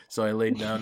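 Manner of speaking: normal talking voice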